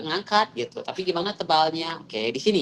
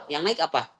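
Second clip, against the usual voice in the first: normal talking voice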